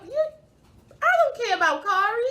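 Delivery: loud, high, quick retort; defiant outburst. Real, not acted. Defiant retort about absent Kari.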